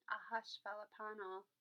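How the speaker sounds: ordinary delivery, nothing marked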